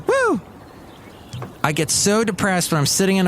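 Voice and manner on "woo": very high-pitched "woo"; mock-celebratory whoop. Host cheering a magazine's closing, high whoop.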